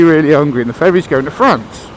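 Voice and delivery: usual speaking voice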